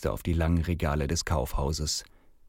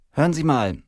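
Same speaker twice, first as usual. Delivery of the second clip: high, raised official voice; policewoman addressing crowd; 8 semitones higher, 5 dB louder than usual. Acted. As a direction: address the parents as a female officer. Policewoman addresses the gathered parents.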